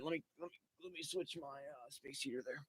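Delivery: very soft aside, half whispered; muttered aside. Real, not acted. Quietly fixing the space heater mid-podcast, soft aside. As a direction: say it off-mic to yourself.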